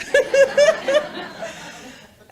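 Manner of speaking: high, loud burst of laughter. Real, not acted. Speaker laughs after imitating her son, loud high laugh.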